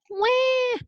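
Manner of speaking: very high wail; baby wail. Acted. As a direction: cry like a baby. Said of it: "said the baby ghost".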